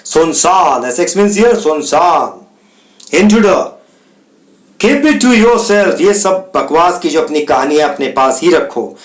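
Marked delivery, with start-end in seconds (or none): 3.2-6.0 Intruder said with heavy stress, keep it to yourself slow and high. Acted. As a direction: lean hard on Intruder, then drag it out. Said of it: "Intruder with emphasis". (Intruder पर ज़ोर देकर, धीमे और ऊँचे)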